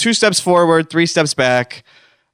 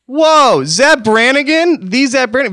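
mock amazement; 9 semitones higher, 4 dB louder than usual. Host greets famous caller.

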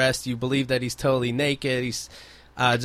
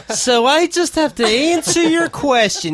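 mock high voice; 11 semitones higher, 4 dB louder, slower than usual. Mock exchange with the woman.

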